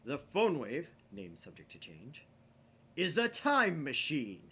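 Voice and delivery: loud, slow reveal with a hushed aside; climactic reveal. Acted. Okabe unveils the time machine to Kurisu, booming. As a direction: unveil it like a showman.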